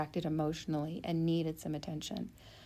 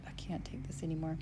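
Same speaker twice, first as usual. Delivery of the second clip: exasperated whisper; a hushed, worn-out whisper; whispered despair; 5 dB quieter, faster than usual. Retold or imitated. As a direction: whisper it like you're at the end of your rope. Quoting own exasperated thought.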